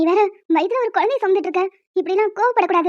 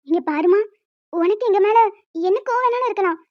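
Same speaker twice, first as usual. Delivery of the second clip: ordinary talk, nothing marked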